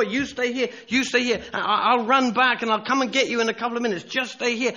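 put-on high, hurried instructions to a child; urgent father. Father hurriedly instructs his little boy.

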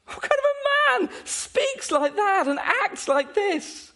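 very high-pitched rhetorical question; incredulous. Real, not acted. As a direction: ask it in soaring amazement. Rhetorical question at peak of amazement.

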